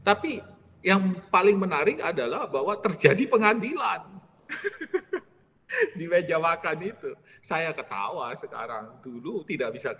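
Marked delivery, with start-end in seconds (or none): 4.5-5.2 light high laugh. Real, not acted. Preacher laughs recalling the dinner-table trial.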